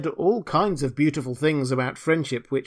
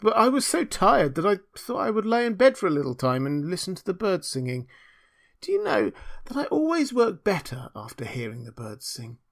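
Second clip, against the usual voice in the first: lightly voiced meek friend; apologetic little Hans; 6 semitones higher than usual. A narrator's reading. Hans excusing himself to the miller, mildly apologetic. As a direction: make a mild, sorry excuse.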